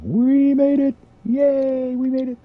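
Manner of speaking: high-pitched squealed cheer; playful celebration. Pretend. Celebrates arriving in the new galaxy.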